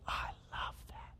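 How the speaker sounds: breathy, voiceless whisper of delight; soft whisper of fond approval. Retold or imitated. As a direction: whisper it like a tender secret. God fondly approving, whispered.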